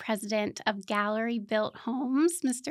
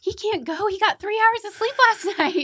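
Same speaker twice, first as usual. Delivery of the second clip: high, loud laughing protest; laughing exclaim; 11 semitones higher, 7 dB louder than usual. Retold or imitated. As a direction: cry out in amused disbelief. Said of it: "I was like".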